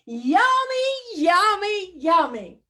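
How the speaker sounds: greedy pigeon. Acted. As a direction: savour each yummy like a greedy bird. Pigeon savours the hot dog.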